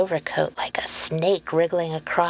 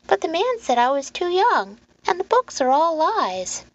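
put-on high-pitched child's objection; protesting little boy; 11 semitones higher, 3 dB louder than usual. Boy telling Lenora what the man said, protesting.

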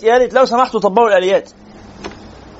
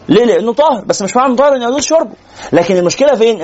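usual speaking voice

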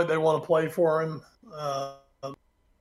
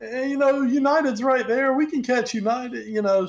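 lightly voiced, higher smug fan; smug Arsenal fan; 7 semitones higher than usual. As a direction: brush it off like a complacent fan. Voicing complacent Arsenal fans.

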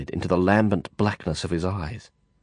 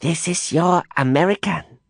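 high, cold judging voice; imperious matriarch; 8 semitones higher than usual. Acted. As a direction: weigh up the stranger coolly. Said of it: "Madame Zhou said".

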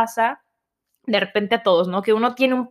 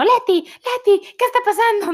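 high, louder alarmed call; panicked dad; 11 semitones higher, 4 dB louder than usual. Retold or imitated. Worried dad on the phone calling to mom, high alarmed voice. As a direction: call her name in alarm.

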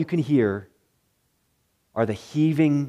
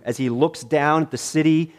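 usual speaking voice

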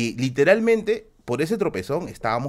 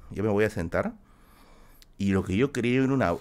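normal talking voice

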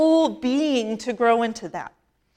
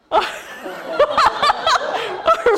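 big laugh; 14 semitones higher than usual. Real, not acted. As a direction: let the laugh roll out. Laughs at her own blunder.

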